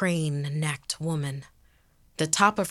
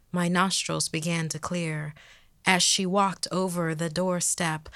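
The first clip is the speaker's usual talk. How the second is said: usual speaking voice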